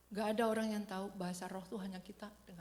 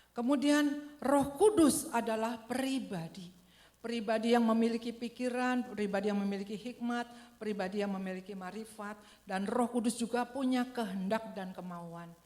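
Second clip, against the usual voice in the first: loud, slow emphatic sermon voice; forceful preaching; 8 dB louder than usual. Acted. Preacher drives home that the Spirit is a person, loud and slow. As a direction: preach it out, pressing each phrase.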